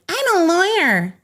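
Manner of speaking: high mocking whine of a self-important lawyer. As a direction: drag it out like a pompous fool.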